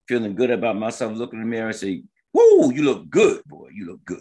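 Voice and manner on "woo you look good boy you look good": whooping mock self-admiration; admiring self-hype. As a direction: hype yourself up in the mirror. Voicing own mirror self-praise.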